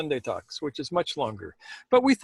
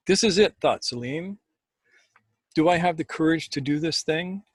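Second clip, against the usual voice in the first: measured, unhurried storytelling voice; story narration; 3 semitones lower than usual. A narrator's reading. Host reading a Sufi story to listeners, calm storytelling. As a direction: read the tale like a spoken legend, letting pauses breathe. Reads a Sufi tale aloud.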